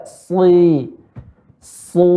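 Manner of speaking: heavy drawn-out "soi", "Su"; thick heavy S. Shown as a demonstration. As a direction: stretch "soi" and "Su", dark and full. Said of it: "Dia huruf tebal".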